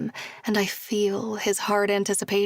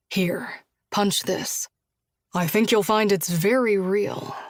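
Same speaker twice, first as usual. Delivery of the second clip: playful dare, voice slightly lowered; lightly voiced man. Josh teases Julia, lightly voiced man.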